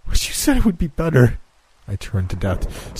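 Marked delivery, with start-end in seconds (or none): 0.1-1.4 high, raw accusation through grief; anguished plea. Acted. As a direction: cry it out at Death, betrayed. Grieving man confronts Death.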